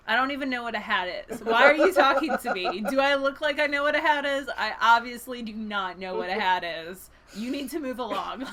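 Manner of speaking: a touch of huffy protesting denial; indignant rabbit. Acted. Rabbit denying to the bear it knows hats, indignant. Retelling defensive rabbit in picture book.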